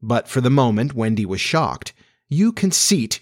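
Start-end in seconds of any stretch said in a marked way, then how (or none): 2.3-3.2 high-pitched, scornful exclamation; frightful sarcasm. A narrator's reading. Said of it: "exclaimed with frightful sarcasm".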